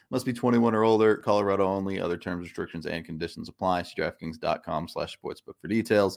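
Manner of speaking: quick rattled-off fine print; fast legal disclaimer. Acted. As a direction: rush through the legal terms.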